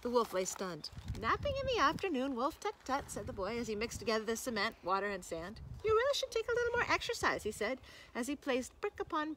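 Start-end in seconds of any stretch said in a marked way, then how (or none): 1.2-3.1 put-on high, tutting boy; mock scolding. Boy chiding the stunned wolf, high mock scolding. 5.8-7.4 very high, quick chiding; mock lecture. Boy advising the wolf while walling him in, high and teasing.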